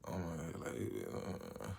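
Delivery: low, soft dazed mumble; soft low spaced-out murmur; stoned mumble. Retold or imitated. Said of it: "I'm just like".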